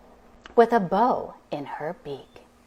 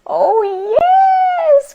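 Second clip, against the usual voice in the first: very high, loud happy cry; excited cry; 10 dB louder than usual. Acted. Witch welcoming the frog, very high joyful cry. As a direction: call out with joy. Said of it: "cried the witch".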